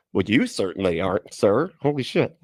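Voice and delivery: ordinary delivery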